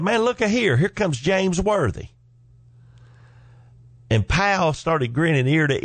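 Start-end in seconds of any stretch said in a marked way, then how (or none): 0.0-2.1 much higher, delighted mock announcement; teasing call. Daddy ribbing pal in powder blue tuxedo, high teasing call. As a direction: hail him like a star arriving.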